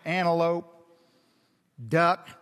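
name the animals like nothing matters; bored naming. Retold or imitated. Adam naming livestock, low bored monotone. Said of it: "He's just going".

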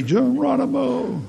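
high, slow drawn-out Geronimo; coaster yell. Retold or imitated. Pastor yells on the roller coaster drop, high and drawn out. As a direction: shout it long as the coaster drops. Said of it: "I like to say Geronimo".

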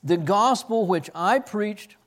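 scripture reading. Acted.

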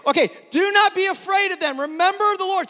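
high, loud rallying shout; rally the crowd with full force; preaching shout. Real, not acted. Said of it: "preacher leads the crowd".

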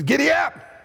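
high, pushed giddy ap. Retold or imitated. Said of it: "He says".

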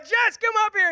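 high-pitched re-enacted yell; yelled call. Dad yells for his wife to come up, re-enacting it. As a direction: call out as if to someone downstairs. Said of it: "I just yelled".

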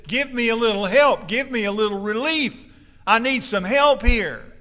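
loud, desperate plea to Abraham; crying out for help. Acted. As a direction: beg for relief at full voice, slowing at the end. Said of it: "the rich man cries out to Abraham for a little help".

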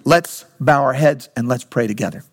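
ordinary delivery

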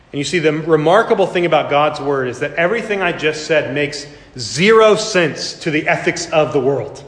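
ordinary talk, nothing marked

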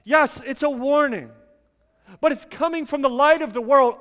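ordinary delivery

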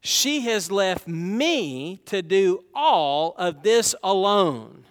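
slow, weight on "all" and "this"; slow, deliberate stress on "all"; emphatic reading. Shown as a demonstration. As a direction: drag out "all" and "this alone" for emphasis. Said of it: "Notice the emphasis in what she says".

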